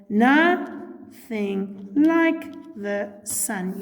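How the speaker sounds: slow, separated syllables for counting. Shown as a demonstration. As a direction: draw out each syllable of "nothing like the sun". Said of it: "there are ten syllables in a line".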